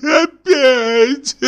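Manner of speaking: high whimpering laugh-cry; pained moaning laugh. Acted. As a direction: moan it out, half laughing. Sick passenger moaning, laughing-crying. (страдальческий смех со стоном)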